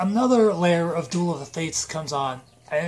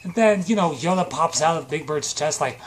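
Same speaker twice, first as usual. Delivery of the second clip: ordinary talk, nothing marked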